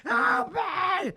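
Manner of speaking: high shouted cry from a tree; very high panicked yell; screaming for help. Retold or imitated. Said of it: "I start screaming".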